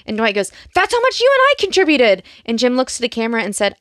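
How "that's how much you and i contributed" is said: high, loud mock outrage; acted flare-up, pitched way up; indignant outburst. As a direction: quote it as an exasperated yell.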